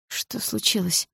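husky, slow, hushed question; hoarse with worry. Acted. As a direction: rasp it out, shaken. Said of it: "хриплым от волнения голосом".

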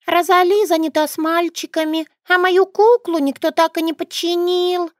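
put-on little girl voice.

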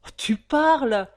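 loud, high exclamation; exclaiming. A narrator's reading. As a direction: burst out with it. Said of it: "exclama Chopin".

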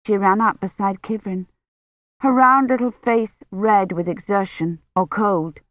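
usual speaking voice

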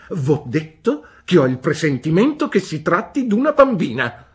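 high, loud, brusque "Bene?"; stern old aunt. A narrator's reading. Aunt Betsy demanding news from the mother, brusque. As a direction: demand an answer like a sharp old lady. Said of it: "replicò Miss Betsy".

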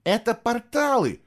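high-pitched, astonished explanation; surprised. A narrator's reading. Boy clarifies to a baffled warrior, surprised. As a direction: explain it, amazed anyone needs telling. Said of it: "удивлённо уточнил Фло".

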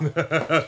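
a brief amused chuckle; short laugh. Acted. Shopkeeper chuckling after the old woman leaves. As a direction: laugh her off lightly.

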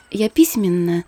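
ordinary delivery

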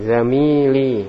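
"Zamili" with the middle syllable held long. Shown as a demonstration. Teacher corrects a student's reading, "Zamili" with long "mi". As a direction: draw out "Zamili" very slowly on the "mi". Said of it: "Yaminnya panjang".

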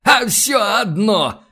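put-on slow, high-pitched dismissive old codger; relieved grunting old man. A narrator's reading. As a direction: drawl it out like a relieved grandad. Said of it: "хмыкнул облегчённо выдохнувший Трегубов".

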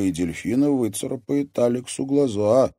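ordinary delivery, nothing marked

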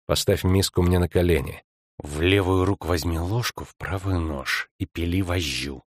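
low, hushed instructions; conspiring prisoner. Acted. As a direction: order her under your breath, unseen.

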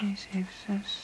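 whispered repeat while writing; murmured repeat. Acted. Mother writing down the caller's number, in a murmur. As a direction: mouth the digits under your breath.

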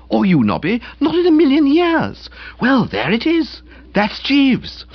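high, excited exclamations; marvelling. A narrator's reading. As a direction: exclaim in hearty admiration. Friends marvel at Jeeves's feat.

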